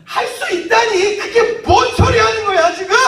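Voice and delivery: high, drawn-out indignant yell; angry shout. Acted. Jesus yelling at the startled father, angry shout. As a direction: bellow it in outraged disbelief. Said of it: "소리를 질러서 이야기하니까".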